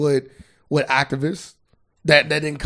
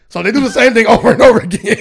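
higher, louder, laughter breaking into the words; laughing; 5 semitones higher, 5 dB louder than usual. Real, not acted. Host challenging friend's circular argument, laughing. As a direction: challenge him while cracking up.

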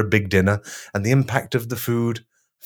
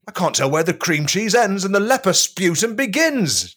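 mock shout; 10 semitones higher than usual. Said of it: "Jesus shouted".

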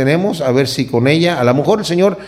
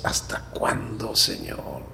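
breathy whispered plea, slow; whispered lament; 7 semitones lower, 13 dB quieter, slower than usual. Acted. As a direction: whisper it as a weary prayer. Psalmist's lament quoted in sermon.

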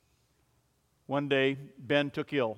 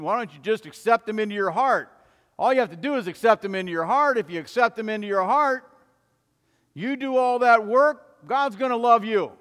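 higher and louder, urgent appeal; pleading sister; 8 semitones higher, 8 dB louder than usual. Acted. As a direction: press him hard to accept. Sister pressing Ben to accept Jesus.

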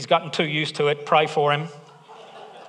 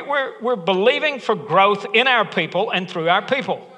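ordinary delivery

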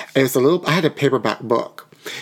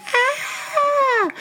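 retold scream. Retelling the schnauzer grabbing his duck, high mock shriek. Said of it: "and I screamed".

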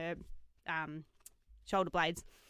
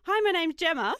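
laughing intro; 9 semitones higher, 7 dB louder than usual. Retold or imitated. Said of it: "I was like".

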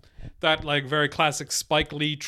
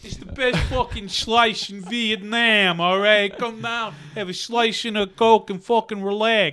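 put-on New York Italian patter, higher; Italian-American pizzeria owner; 4 semitones higher than usual. Pizzeria owner selling slices in Vietnam, cocky Italian-American voice. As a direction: pitch the slice like a Brooklyn pizza man.

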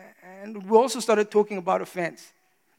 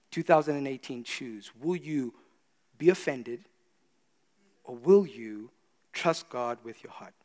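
hushed solemn appeal; 7 semitones lower, 9 dB quieter than usual. Real, not acted. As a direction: lay the choice down gently. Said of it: "solemn quiet appeal".

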